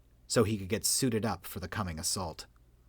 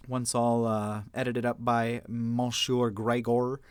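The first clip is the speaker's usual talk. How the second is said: a weak, lightly French "Monsieur Gregor"; half-hearted French accent, slowed down; terrible French accent; slower than usual. Shown as a demonstration. Narrator signing off, naming the editor in a bad French accent. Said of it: "my really, really terrible French accent".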